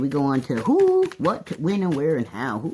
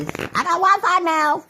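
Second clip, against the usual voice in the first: excited, laughing; 3 dB louder, slower than usual. Real, not acted. Excited about new Wi-Fi.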